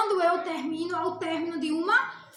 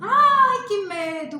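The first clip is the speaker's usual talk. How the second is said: sudden loud, high "Ai" then "que medo"; a hammed-up little scare; mock fright; 4 semitones higher, 9 dB louder, much slower than usual. Shown as a demonstration. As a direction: squeal it like a scared kid. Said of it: "com medo".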